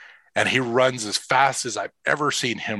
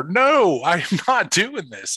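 a scared kid digging in his heels, imitated; imitating a nervous son refusing to go in; panicked refusal; 9 semitones higher, slower than usual. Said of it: "He's, like, sitting there".

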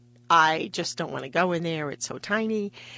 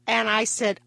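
normal talking voice

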